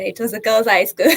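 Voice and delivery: normal talking voice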